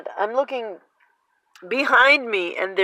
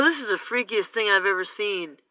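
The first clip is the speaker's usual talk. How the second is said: ordinary delivery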